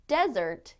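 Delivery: "desert" stressed on the first syllable, DEZ-ert. Shown as a demonstration. Teacher showing the noun, "desert" stressed up front, DEZ-ert. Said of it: "depending on the way we stress the word".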